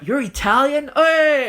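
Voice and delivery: high, loud Italian-American mock greeting; hammed-up Italian-American cheer of recognition. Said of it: "a whole bunch of Italian jokes".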